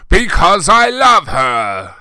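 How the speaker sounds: loud, high, slow declaration; dramatic confession. A narrator's reading. Hagrid dramatically confessing love for Ebony, loud and slow. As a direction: declare love with mock passion.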